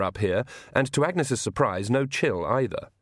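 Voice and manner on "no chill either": ordinary delivery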